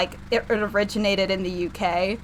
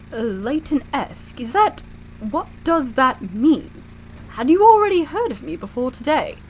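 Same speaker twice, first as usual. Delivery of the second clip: puzzled British professor; 3 semitones higher than usual. Acted. Professor asks assistant about an odd word, puzzled British voice.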